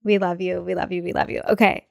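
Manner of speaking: ordinary talk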